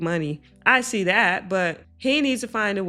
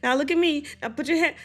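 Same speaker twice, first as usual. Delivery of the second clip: higher-pitched mock brisk directing; mimicked dismissive mom; 7 semitones higher, faster than usual. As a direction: imitate a mother brushing him off. Mimics mother dismissing crying son.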